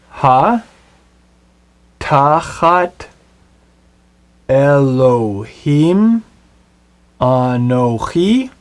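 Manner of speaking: each Hebrew word read slowly, apart, with pauses; slow spelled-out Hebrew reading. Shown as a demonstration. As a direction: read Ha tachat Elohim anochi word by word, slowly. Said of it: "Let's read the Hebrew of his question together".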